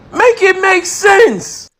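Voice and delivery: slow, drawn-out plea in an odd voice; exasperated demand. Real, not acted. Exasperated plea to the viewers, slow and drawn out. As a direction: stretch it out, fed up.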